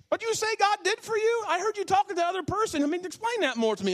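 very high, keen asking voice; a hint of an eager stranger; eager questioner. Stranger asking about God.

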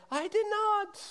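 very high, far-off punchline voice; hammed-up offstage denial; God's voice from next room. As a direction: deliver the punchline as a huffy offstage voice. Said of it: "you hear the voice say".

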